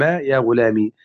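يا غلامي ending in "i", ghulami; final kasra. Shown as a demonstration. Teacher showing students three vowels on the meem, "i" ending on يا غلامي. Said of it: "أي بثلاث حركات على الميم".

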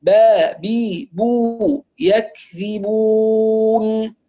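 با بي بو with light b; thin ba. Shown as a demonstration. Teacher drills students on the thin ba. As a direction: chant يكذبون slowly with a light b. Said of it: "والباء طبعًا مرققة".

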